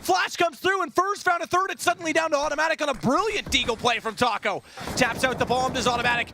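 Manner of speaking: high, hyped play-by-play shout; excited shouting. Real, not acted. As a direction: yell it as the clutch unfolds. Caster erupts on clutch play.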